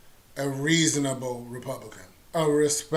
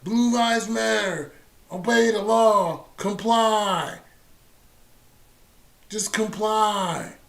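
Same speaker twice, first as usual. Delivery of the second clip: mocking quote; 7 semitones higher, 4 dB louder, slower than usual. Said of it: "all these people that are like".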